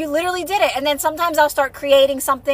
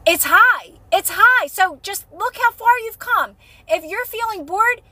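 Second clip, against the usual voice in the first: excited encouragement; 6 semitones higher than usual. Real, not acted. Excited encouragement about emotional scale.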